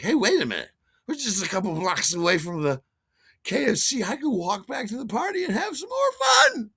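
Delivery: high, excited build to a shout; reveller's gleeful realisation; acted bright idea. Acts out drunken bright idea.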